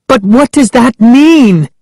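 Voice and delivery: high, alarmed question; Sari crying out. A narrator's reading. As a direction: cry it out in a frightened, high voice. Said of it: "Sari cried".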